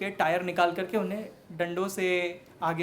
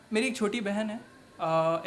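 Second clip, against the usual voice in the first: normal talking voice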